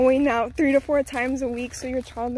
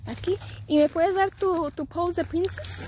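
high, playful asking; 6 semitones higher than usual. Real, not acted. Mom asking her toddler for a princess pose, high playful coax.

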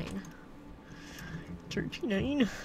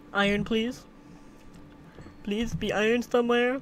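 normal talking voice

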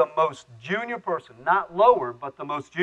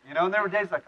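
usual speaking voice

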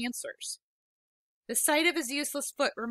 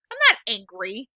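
tense denial; 10 dB louder than usual. Acted. Denying anger, forcing calm.

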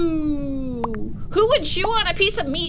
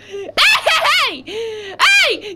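laughing hey; 6 semitones higher, 4 dB louder, much slower than usual. Real, not acted. Laughing calls.

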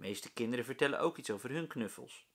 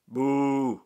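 loud booing shout; booing class; 11 dB louder than usual. A narrator's reading. The whole class boos their teacher, loud. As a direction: boo him down as a whole class. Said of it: "roept de hele klas".

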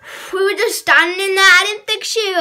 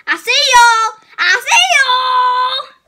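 menacing shout; 7 semitones higher, 6 dB louder, slower than usual. Pretend. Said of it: "louder take of same line".

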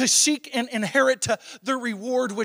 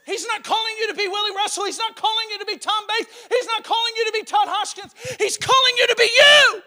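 high, loud, driving sermon cry; full-force preaching; 11 semitones higher, 7 dB louder than usual. Real, not acted. Pastor urging young believers, loud preaching. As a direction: preach it hard and rising.

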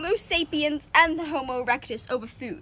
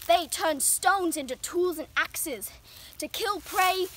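ordinary talk

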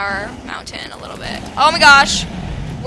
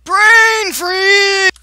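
much higher, louder disbelieving shout; shocked shout; 9 semitones higher, 13 dB louder than usual. Real, not acted. Gamer spotting player Brain Freeze, shocked shout. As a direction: yell the name in disbelief.

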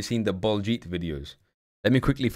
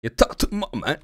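mimicking the clip voice; 6 semitones higher than usual. Repeating the clip's line.

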